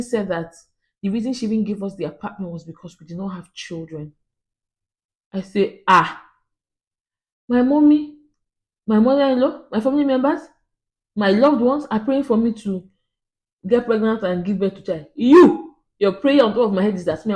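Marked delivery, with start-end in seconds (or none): 5.9-17.3 loud, high indignant protest; indignant outburst. Retold or imitated. Telling the landlady off, loud and indignant. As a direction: throw the words back at her, louder.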